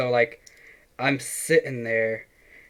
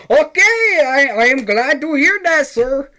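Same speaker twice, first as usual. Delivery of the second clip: much higher, louder hammed-up Indian caller accent; Indian scam caller accent; 14 semitones higher, 7 dB louder than usual. Retelling a scam call, hamming up the caller's Indian accent loudly. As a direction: ham up the eager Indian caller. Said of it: "like, an Indian guy".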